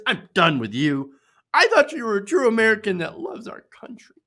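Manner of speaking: hammed-up outraged Trump fan, loud and high; mock a furious commenter; exaggerated angry fan voice. Reading an angry fan comment aloud, hammed-up outrage. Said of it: "Voice exaggerated, of course".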